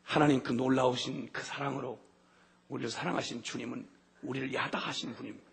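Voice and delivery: ordinary talk, nothing marked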